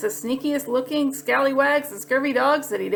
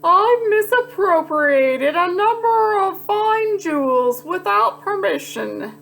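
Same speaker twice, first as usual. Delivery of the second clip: much higher, slow and proper; prissy gentleman; 8 semitones higher, slower than usual. Acted. Rascal politely confessing to Pete. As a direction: speak like a fussy aristocrat. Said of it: "said the rascal".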